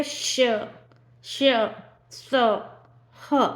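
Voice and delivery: श, श, स, ह each breathed out alone, with pauses between; letters sounded out. Shown as a demonstration. Teacher demonstrating the breathy letters to students, slow and separate. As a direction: push warm air out on श, स, ह. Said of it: "ऐसे बोल के देखिए".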